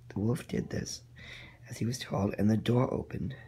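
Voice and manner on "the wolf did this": ordinary delivery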